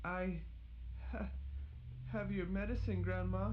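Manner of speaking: high, halting with fear; Little Red stammers to grandmother in fear; frightened stammer. Acted. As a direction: stammer it out scared. Scared, stammering.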